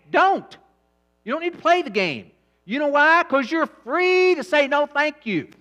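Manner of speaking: loud, high, hammering preacher voice; full-force preaching. Acted. Preacher to congregation about overeating, full-force preaching. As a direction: pound each line at the crowd.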